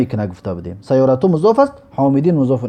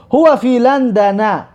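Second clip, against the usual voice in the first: slow example reading; 5 semitones higher, much slower than usual. Shown as a demonstration. Teacher reads Arabic example.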